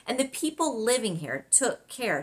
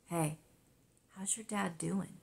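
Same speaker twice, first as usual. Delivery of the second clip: softer, low and confidential; 11 dB quieter than usual. Acted. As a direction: keep it down so the others can't hear. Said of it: "said in a low voice".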